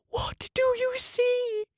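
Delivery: high, slow breathless whisper; terrified whisper. A narrator's reading. Roberta begs the doctor in a high, slow terrified whisper. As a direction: barely get the words out in fear.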